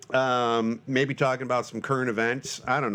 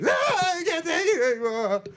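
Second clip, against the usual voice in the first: his own freak-out; 14 semitones higher than usual. Shown as a demonstration. Host repeats his freak-out impression, now a full shout. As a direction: yell it like you've truly snapped. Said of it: "that's my impression of me".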